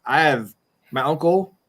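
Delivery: ordinary delivery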